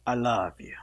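low, soft, breathy lover's whisper; romantic accent. Acted. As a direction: whisper it like a smitten suitor. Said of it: "you gotta talk in a real good accent".

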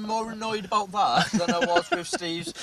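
high, giggling through the words; laughing. Real, not acted. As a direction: laugh while you confess it. Laughing while admitting annoyance.

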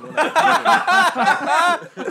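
laughing through the words. Retold or imitated. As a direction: crack up while reading it out. Retelling his search, laughing.

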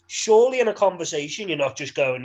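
ordinary delivery

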